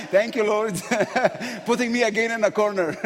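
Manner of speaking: quick words spilling out through laughter; laughing through words. Real, not acted. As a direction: laugh it off, ruefully amused. Laughs at being cornered again.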